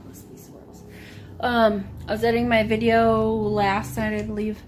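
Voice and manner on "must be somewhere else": whispering. Real, not acted. Searching, voice drops.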